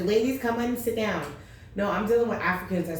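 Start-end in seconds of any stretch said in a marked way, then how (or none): 0.0-1.2 mild imitation of a school counselor; a hint of a gentle counselor calling the girls in. Imagined counselor calling the quarreling girls in, lightly voiced. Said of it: "counselor was gonna come out and say".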